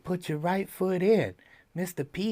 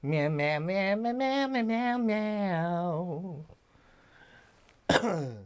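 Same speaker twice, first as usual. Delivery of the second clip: mock meowing; much slower than usual. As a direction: meow back at the kitten like you're chatting. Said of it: "Did they say".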